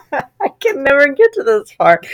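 real giggles, voice pushed high; laughing while talking. Real, not acted. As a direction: crack up as you speak. Cracking up while reading.

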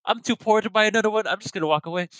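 very high-pitched put-on voice; mock wounded friend. Friend too poor to replace his food, mock wounded. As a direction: pitch it way up, playing hard done by. Voicing friend whose food was knocked away.

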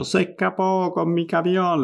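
upset greengrocer reporting the theft; a hint of an indignant shopkeeper; greengrocer complaining. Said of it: "le dice el verdulero".

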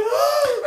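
squeaky laughing yelp; hammed-up high yelp; high mock yelp. Retelling his reaction, squeaky laughing yelp.

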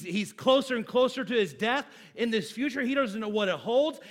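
normal talking voice

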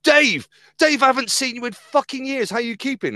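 loud, high, delighted shout across the pub; overfriendly greeting. Retold or imitated. Friend hails a stranger in the pub, loud and overjoyed. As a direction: hail him like a long-lost schoolmate.

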